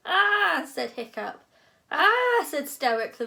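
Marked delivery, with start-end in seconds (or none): 0.1-0.7 loud high "aah"; horrified cry. A narrator's reading. Hiccup's horrified cry at mess. 1.9-2.4 very loud, high "aah"; bellowed cry of horror; shocked yell. A narrator's reading. Stoic cries out on entering.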